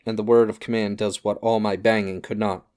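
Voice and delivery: ordinary delivery, nothing marked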